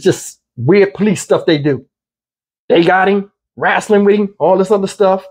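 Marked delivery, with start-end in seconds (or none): none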